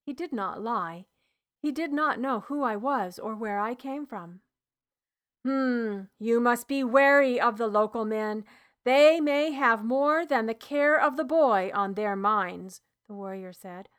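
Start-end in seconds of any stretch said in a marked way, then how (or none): 5.4-12.8 a lightly voiced Roman officer, a little louder; a firm soldier giving a warning, only slightly put on; stern centurion. Roman centurion warns the healer.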